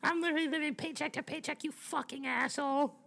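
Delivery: very high-pitched mocking caricature; put-on high voice mocking the complainers; whiny annoying critic. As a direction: squeal it out as an exaggerated, annoying complainer. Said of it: "that's how everyone sounds in my head whenever they're annoying me".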